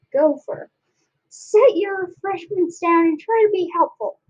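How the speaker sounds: put-on high, bossy old-timer voice; barking grandpa orders. Grandpa ordering the panicked family about, barking. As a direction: take charge of the room with authority. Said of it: "barking orders from the corner".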